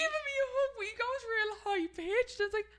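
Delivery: high, teary laughter; laughing. Real, not acted. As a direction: talk through the giggles. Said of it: "laughing through telling".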